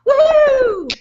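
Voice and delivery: high-pitched mock whoop; put-on, celebratory high woohoo; mock cheer. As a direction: cheer like an over-excited attendee. Mocking excited webinar attendee's cheer.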